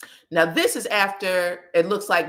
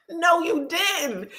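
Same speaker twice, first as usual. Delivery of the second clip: high, drawn-out amazement; scandalized disbelief; 8 semitones higher, slower than usual. Real, not acted. Reacting to a mother calling out her son, scandalized disbelief. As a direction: stretch it out high, amused and scandalized.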